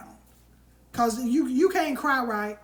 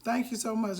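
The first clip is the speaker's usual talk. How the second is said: ordinary talk, nothing marked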